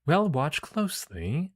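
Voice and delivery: high, slow, drawn-out mentor voice; teasing mentor character. Acted. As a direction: draw it out as a teacher about to demonstrate. Mentor voiced in game story.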